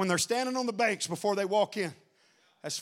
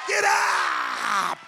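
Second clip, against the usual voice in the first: shouted command. Acted. Preacher shouting at the congregation to stand. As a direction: call them to their feet.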